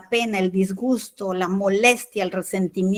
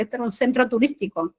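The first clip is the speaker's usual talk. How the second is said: ordinary talk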